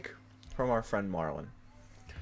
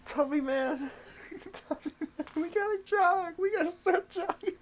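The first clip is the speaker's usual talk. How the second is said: cutesy voice to cat; 10 semitones higher than usual. Acted. High coaxing voice to cat.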